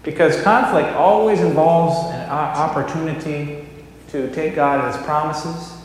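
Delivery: loud, emphatic pulpit delivery with bursts of force; Sunday sermon, firm and loud; sermon preaching. Real, not acted. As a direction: preach it to the congregation, pressing each point. Pastor preaches after the skit.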